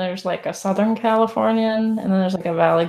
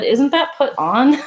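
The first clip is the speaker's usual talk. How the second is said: slower, higher doubtful question; skeptical question; 6 semitones higher, 4 dB louder than usual. Retold or imitated. Retelling cohost asking if the accent is fake, doubting. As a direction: ask it like you suspect it's fake. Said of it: "You said".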